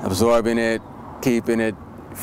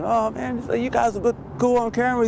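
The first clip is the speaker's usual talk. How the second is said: high, light mock Giorgio voice; imitating Giorgio; 12 semitones higher than usual. Giorgio met at a party, imitated in a high, excited voice. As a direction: lifts into a playful impression of Giorgio. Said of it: "he starts talking".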